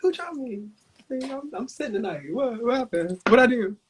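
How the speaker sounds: high, mock-innocent voice asking around; mock innocent confusion. Performer retelling a ballroom chop, playing innocently confused. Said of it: "I say".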